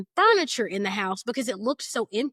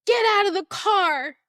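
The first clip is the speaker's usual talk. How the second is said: higher, louder barked command; mock police shout; imitating a scream; 8 semitones higher, 5 dB louder than usual. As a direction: replay the officer yelling at her. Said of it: "And he screams".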